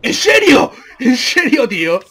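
high, loud incredulous cry; loud disbelief. Real, not acted. Gamer protesting that the opponent still survives, loud disbelief.

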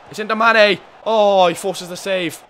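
high, loud, slowing on the save; excited chance. Real, not acted. Commentating a chance on goal, excited. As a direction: build up then groan at the save.

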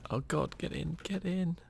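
high, loud, urgent plea; panicked urging. Real, not acted. Fleeing bear into shelter.

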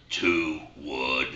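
a low, slow little frog calling out the club; talking frog. Acted. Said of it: "he hears".

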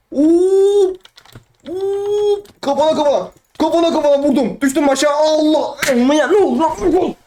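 high alarmed yelps. Real, not acted. Gamer hit on the head and falling, high alarmed yelps. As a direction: cry out in shock as you fall.

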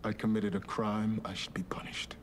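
soft, unvoiced confession; whispered confession. Quiet confession.

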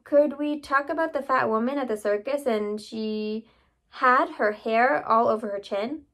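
usual speaking voice

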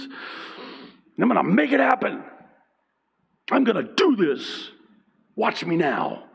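high, driven declaration; gung-ho determination. Acted. Acting out driven younger self.

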